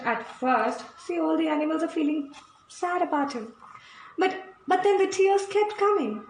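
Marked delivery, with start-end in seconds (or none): none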